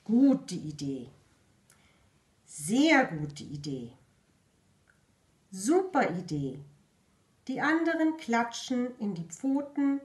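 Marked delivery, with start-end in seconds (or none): 0.0-6.6 lower, slower and louder critter voice; slow, booming approval; deep animal voice. Acted. Animals agree.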